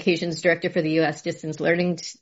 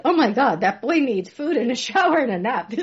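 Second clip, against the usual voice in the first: mock horrified mom; 6 semitones higher than usual. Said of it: "I'm like".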